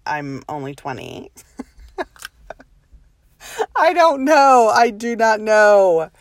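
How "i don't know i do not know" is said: laughing. Real, not acted. Laughing at her own worry.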